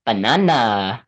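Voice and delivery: a hint of a minion saying banana. Shown as a demonstration. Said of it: "when I'm doing a minion impression".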